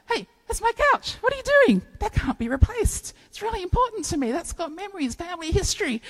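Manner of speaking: high, indignant squeal; outraged protest. Pretend. Homeowner protesting as Jesus throws out the couch, high and indignant. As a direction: yelp at him to stop.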